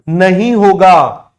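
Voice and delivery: teacher drives home that it won't happen, slow and loud; emphatic denial. Real, not acted. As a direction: lean hard on नहीं होगा after the pause. Teacher stressing the key exception.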